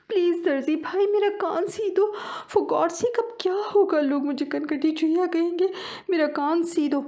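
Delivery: distressed mouse pleading with the tailor; begging mouse. Acted. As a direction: beg the tailor, high and frantic.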